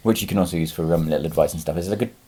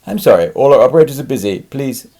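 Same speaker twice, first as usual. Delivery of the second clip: mock phone recording; 3 semitones higher, 8 dB louder than usual. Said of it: "it does that".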